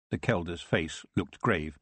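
plain reading voice

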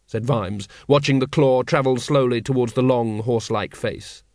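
ordinary delivery, nothing marked

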